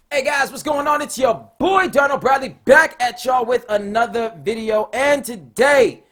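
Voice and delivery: loud, pumped-up YouTuber intro; energetic channel greeting, loud and stretched; hyped intro shout. Shown as a demonstration. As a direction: hit the opener with big energy, slowing at the end. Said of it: "So here you go".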